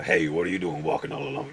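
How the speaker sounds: a lightly voiced big thug calling out from a truck; big menacing guy. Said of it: "it's these big".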